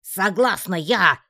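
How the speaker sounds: loud, high, drawn-out shout; full-throated yell. A narrator's reading. Heroine gives in so all can hear, yelling. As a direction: yell your consent in defiance. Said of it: "заорала я во всю глотку".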